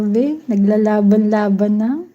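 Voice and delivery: ordinary talk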